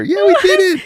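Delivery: very high, loud mock cheer. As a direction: cheer like a cheesy movie couple. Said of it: "They actually cheer".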